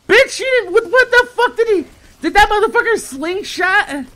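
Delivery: high, loud disbelieving outburst; incredulous outburst. Retold or imitated. Seeing the missed-you slip on the door, high outraged disbelief. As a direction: squeak it out in shocked outrage.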